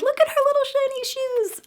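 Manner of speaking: high cooing voice; cutesy. Real, not acted. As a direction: gush over the tiny shoes, pitched high. Cooing over teddy bear's shoes.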